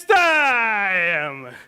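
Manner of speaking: imitating a fight announcer with a long, shouted final word; boxing ring announcer. Speaker of the combat introduces the exam fight, ring announcer's shout. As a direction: stretch the last word into a big falling ring call. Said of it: "my entry would be something like this".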